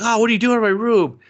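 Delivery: high, loud, fast outburst; indignant yell. Retold or imitated. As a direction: yell at the sister barging in. Brother annoyed at sister.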